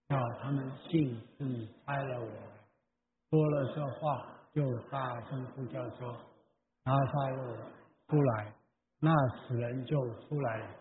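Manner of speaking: quiet group recitation of scripture, far off; congregational scripture reading. Acted. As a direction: read the Bible aloud together softly. 会众一起朗读经文. (会众一同轻声朗读经文)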